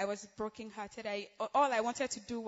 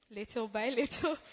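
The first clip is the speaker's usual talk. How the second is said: laughing. Real, not acted. Laughs mid phrase.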